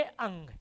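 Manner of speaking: low, soft "अंग" closing on nasal ng; correct letter sound. Shown as a demonstration. Teacher answering own question on the letter's pronunciation, nasal ng. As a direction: drop "अंग" quietly as the model answer. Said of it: "इसका उच्चारण क्या करोगे".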